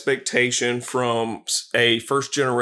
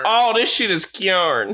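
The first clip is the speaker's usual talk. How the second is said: slow, high sassy auntie; 6 semitones higher than usual. Pretend. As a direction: drawl it like a fussing auntie. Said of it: "continuing the bit".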